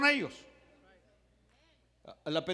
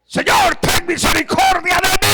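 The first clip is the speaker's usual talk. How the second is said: full-throated shout; 12 semitones higher, 16 dB louder than usual. Acted. Bartimeo screaming to Jesus for mercy, full force. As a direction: scream the plea, stretching the end. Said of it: "gritaba como hombre".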